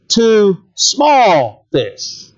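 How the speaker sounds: "small" drawn out very slowly and leaned on; slow, weighty "small" in the middle of the phrase; stress on small. Shown as a demonstration. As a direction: drag out "small" to show how tiny they are. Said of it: "It doesn't just say fish".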